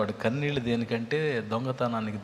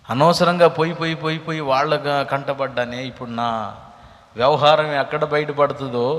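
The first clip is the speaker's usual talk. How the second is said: fiery preaching; 3 semitones higher, 10 dB louder than usual. Acted. Preacher warning the congregation about hidden affairs, loud and rising. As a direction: thunder the warning at the crowd.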